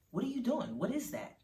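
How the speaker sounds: mother taken aback, pitch lifted; a little offended. A narrator's reading. Mother challenging her child's sarcasm, mildly offended. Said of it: "feeling a little offended".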